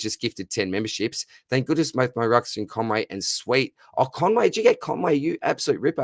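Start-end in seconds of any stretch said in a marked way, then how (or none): 4.0-6.0 high, delighted burst of thanks; real excitement, pitch jumping up; excited thanks. Real, not acted. Streamer thrilled at a gift, excited high outburst.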